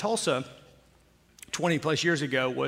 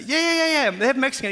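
high, eager rush of yeahs; breathless, delighted yeah yeah yeah; retold excitement; 8 semitones higher, 4 dB louder than usual. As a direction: gush like a kid who found his restaurant. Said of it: "So I'm, like, excited".

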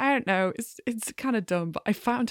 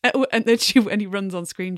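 usual speaking voice

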